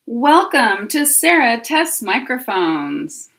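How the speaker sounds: loud, drawn-out presenter welcome; sing-song host's welcome; show intro. Pretend. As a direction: announce it big and slowly, with swooping pitch. Recording a test show intro.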